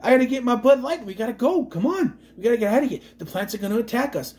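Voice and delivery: mock Boston bro urgently rallying the crew; Mark Wahlberg Boston impression. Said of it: "I'm waiting for him to go".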